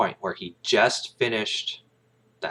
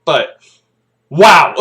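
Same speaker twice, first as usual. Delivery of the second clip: raised, loud stunned exclamation; 9 semitones higher, 13 dB louder than usual. Retold or imitated. Kaffee reacts to the confession, stunned and raised. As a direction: let the shock spill out. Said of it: "He's like".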